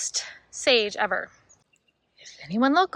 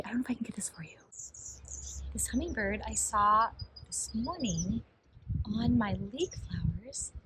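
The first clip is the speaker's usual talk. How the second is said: hushed whisper, much softer, mostly breathy air; 8 dB quieter than usual. Real, not acted. Gardener filming hummingbirds whispers so they stay. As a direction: whisper so you don't scare the bird. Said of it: "I'm, like, whispering".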